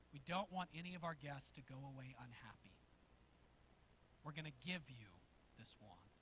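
low, soft, measured manager's voice; hushed concession. Retold or imitated. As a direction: let it land quietly, with pauses. Manager finally gives in, dramatic climax.

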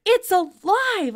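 high, loud cry of alarm; bursting in with a shout. A narrator's reading. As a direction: yell it out, full of shock. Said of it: "I shouted".

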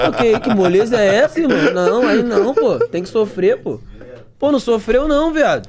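high, loud teasing objection; loud mock outrage. Real, not acted. Telling the victim he should have suffered, loud mock outrage. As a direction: object noisily, winding your friend up.

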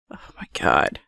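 breathless shocked whisper. Real, not acted. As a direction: gasp it under your breath.

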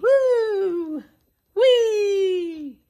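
high whooping cheers; playful cheers. Acted. Host cheering with children during the dance. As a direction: whoop like you're dancing.

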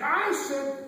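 screaming teacher. Acted. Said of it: "she starts screaming".

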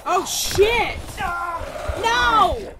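high, loud frightened yells; panicked screaming. Real, not acted. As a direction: scream as something grabs you. Attacked in game, screaming.

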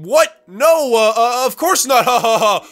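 flustered denial. Acted. Nervous denial, caught peeing.

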